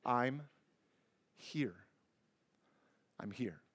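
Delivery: soft, fading hush of reassurance; soothing a panicked child. Retold or imitated. Parent to a panicked child, soothing and fading to a hush. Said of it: "Let him grab your face and say".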